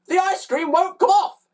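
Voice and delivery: high, loud frustrated bellow. A narrator's reading. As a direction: bellow in exasperation. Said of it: "he bellowed".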